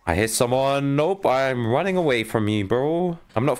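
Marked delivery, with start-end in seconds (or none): none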